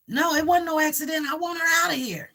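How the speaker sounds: mildly defiant mock child; insistent child quote. Acts out child's defiant statement.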